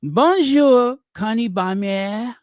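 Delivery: high-pitched French-accented greeting. Shown as a demonstration. Host showing how to greet Connie, French accent. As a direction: lift the name in a French accent. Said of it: "say it with that French accent".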